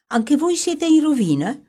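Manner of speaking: can't believe his ears; young man stunned that they are ruined too; astonished. A narrator's reading. Young man stunned by their ruin.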